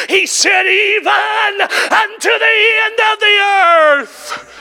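high shouted crying preach; fiery sermon climax; preaching at full force. Acted. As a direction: shout the promise with tears in it.

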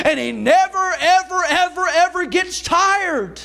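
driving, high-pitched sermon delivery; Southern revival preacher in full flow; full-force preaching. Real, not acted. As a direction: preach it with rising intensity.

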